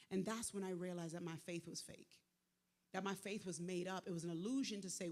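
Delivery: ordinary delivery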